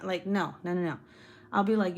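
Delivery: usual speaking voice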